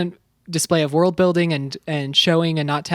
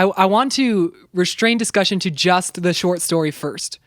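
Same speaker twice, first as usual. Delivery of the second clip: usual speaking voice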